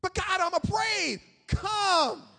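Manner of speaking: forceful, high pulpit delivery; loud preached exchange. Acted. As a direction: call it out like a preacher acting both sides. Acted exchange of believer and God.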